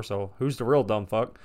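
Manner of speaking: normal talking voice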